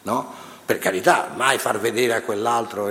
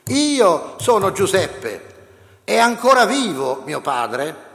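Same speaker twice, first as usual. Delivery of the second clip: higher, louder, slow and stressed on "Io"; bursting out; 9 semitones higher, 4 dB louder, slower than usual. A narrator's reading. Joseph revealing himself to his brothers, loud slow outburst. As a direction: break out with "Io sono Giuseppe" after weeping. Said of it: "proruppe in un grido di pianto".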